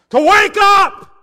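huge high slow shout; full shout. Real, not acted. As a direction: bellow it from the pulpit. Preacher shouts wake-up call.

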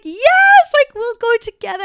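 high, loud excited squeal; excited cheer. Retold or imitated. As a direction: squeal it out like a thrilled fan. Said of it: "being like".

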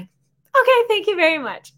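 sugary fake politeness hiding annoyance; fake polite. Wife hanging up on campground woman, mock polite.

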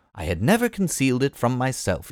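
plain reading voice

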